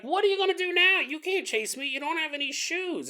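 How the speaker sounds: high squeaky thief taunt. Retold or imitated. Shoe thief taunts the barefoot victim, high and gloating. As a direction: gloat like a cartoon crook. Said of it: "they're like".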